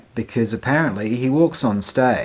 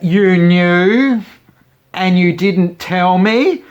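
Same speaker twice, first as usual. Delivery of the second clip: high, loud, slowed outrage; indignant mum; 8 semitones higher, 9 dB louder, much slower than usual. Retold or imitated. Mum outraged her son knew and didn't warn her. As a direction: accuse him, high and loud.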